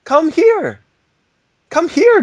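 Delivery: high, loud coaxing call; calling the dog. Real, not acted. Calling the dog over, high and loud. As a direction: coax the dog to come. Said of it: "I can't whistle very well".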